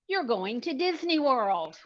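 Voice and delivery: slow, loud, lifted reveal; game-show prize reveal, each word landed; big announcement. Real, not acted. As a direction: spell out the big news with a flourish. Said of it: "So the magic words are".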